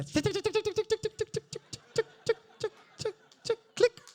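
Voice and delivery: high rapid ticks slowing to a click; ticking noise. Retold or imitated. Woman solving how he sounds, mechanical high ticking. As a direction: tick down like a combination lock. Mimes calibrating, then click.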